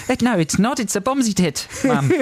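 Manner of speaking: put-on Aussie kid correcting mum; Australian accent. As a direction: argue back in an Australian accent. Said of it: "read that in an Australian accent".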